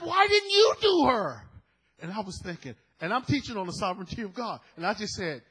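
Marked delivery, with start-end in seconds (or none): 0.0-1.4 slow, louder, higher whine of the upset man; upset cleaner voice. Retold or imitated. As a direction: mimic his indignant question, drawn out. Quoting upset customer again.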